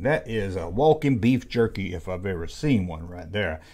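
ordinary talk, nothing marked

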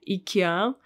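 "ikea" said ee-kee-AH; Russian pronunciation. Shown as a demonstration. Teacher explains the easier way for Russians, "ikea" as ee-kee-AH. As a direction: start "ikea" with ee, Russian style. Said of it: "easier for us to pronounce it this way".

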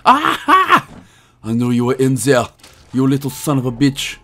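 squeaky triumphant Aha, then an unplaced put-on accent; vague mock accent. Player finding the spider, badly faked gangster accent. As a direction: catch him out in a bad foreign accent. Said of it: "don't know what accent that was meant to be".

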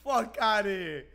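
high, slow, through leftover laughter; laughing. Real, not acted. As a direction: still cracking up, drag it out high. Laughing at "get waves" comment.

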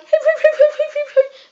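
loud, high-pitched giggling fit; ticklish giggle. Retold or imitated. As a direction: imitate helpless giggles from being tickled. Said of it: "'cause it tickles".

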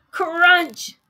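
high loud crunch. Acted. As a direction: bite it out big. Biting sound effect.

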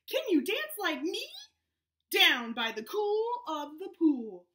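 lively storybook read, voices up high; animated read-aloud to a Zoom class; reading to children. Acted. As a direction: read it out bouncy for little kids. Reads picture book aloud to kids.

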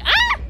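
short, high, loud shriek; startled scream. Real, not acted. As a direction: shriek at a sudden game scare. Screams at game surprise.

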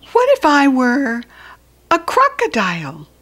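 put-on high, loud pleading child voice; small child asking. Small asking Large about love, a high pleading child voice. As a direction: ask anxiously like a little one.

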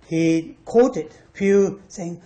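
ordinary talk

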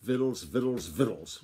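a touch of grumpy snapping; snapped retort. A narrator's reading. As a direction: snap it back, mildly irritated. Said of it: "Grandfather snapped".

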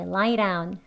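DOWN starting on a quick tapped r; flapped d. Shown as a demonstration. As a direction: say "lie down" as "lai-raun". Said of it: "리을 소리로 들리지요".

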